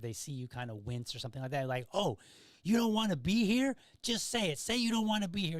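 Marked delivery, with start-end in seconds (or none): 1.9-5.6 taunting drill sergeant. Retold or imitated. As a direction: get in their face and goad them.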